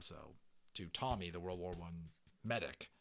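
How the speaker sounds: ordinary delivery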